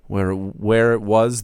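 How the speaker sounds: ordinary delivery, nothing marked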